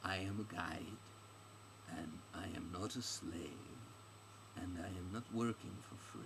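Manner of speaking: lightly voiced guide, soft and deliberate; measured, stubborn guide. Guide refusing to work unpaid, quietly firm. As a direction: refuse with quiet, careful dignity.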